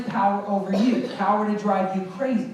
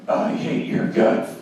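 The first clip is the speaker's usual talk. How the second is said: slow, rasped mock hatred; bully's taunt; 5 dB louder, slower than usual. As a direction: act out a hateful bully, dragging each word. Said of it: "It's like this".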